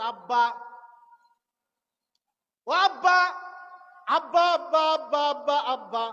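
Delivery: loud pleading calls of Abba; daughter calling. Acted. Daughter calls father from behind, pleading loud calls.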